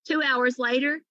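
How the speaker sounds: usual speaking voice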